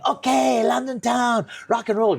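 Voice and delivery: high excited broken English, lightly voiced; imitating Japanese fan. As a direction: chirp it like an eager young fan. Japanese fan's broken English greeting.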